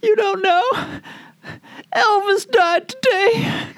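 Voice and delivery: high, slow, sobbing Southern woman; crying Southern accent. Retold or imitated. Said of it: "they had Southern accents".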